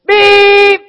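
very loud high beep like a van horn; car horn beep. Retold or imitated. Preacher imitating his son honking the van horn, loud and high. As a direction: blast out a horn honk. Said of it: "Jared's blowing the horn".

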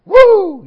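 loud, very high mock "woo"; imitated high cheer; crowd whoop. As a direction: whoop like a jacked-up stadium crowd. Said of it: "they're all jacked up".

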